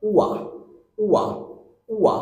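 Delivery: উয়া said short, u gliding into a; diphthong demo. Shown as a demonstration. Teacher drilling উয়া for students. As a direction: slide উয়া from u to a. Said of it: "next one".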